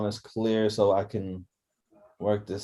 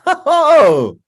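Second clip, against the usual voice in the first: high loud whoop; excited whoop; 13 semitones higher, 12 dB louder than usual. Real, not acted. Excited start of live stream.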